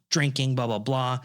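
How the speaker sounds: ordinary talk, nothing marked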